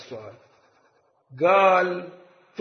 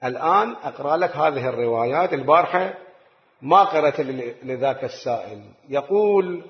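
usual speaking voice

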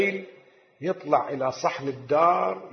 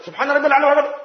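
rushed prayer; 8 semitones higher, 4 dB louder, much faster than usual. Retold or imitated. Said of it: "بعض الناس هكذا يسجدون".

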